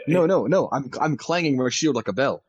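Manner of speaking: loud, high, eager protest; excited. Real, not acted. Excited player explaining clanging.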